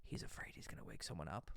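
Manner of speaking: hushed, whispered imitation of a too-quiet speaker; imitating quiet talker. Coach mimicking a client who talks too quietly, whispered. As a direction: whisper it low, as if afraid to wake someone. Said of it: "and it's very quiet".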